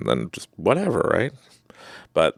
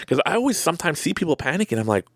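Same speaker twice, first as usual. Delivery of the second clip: ordinary delivery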